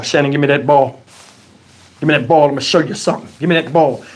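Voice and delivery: low-pitched, gruffly confident dad voice; dad about to show the kids; imitated dad. Acting dad demanding the ball.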